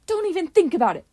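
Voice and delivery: high, loud scolding shout; teacher shouting. A narrator's reading. Teachers scolding Leo from the front, loud shout. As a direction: bellow it like a fed-up teacher. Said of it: "teachers immediately shout".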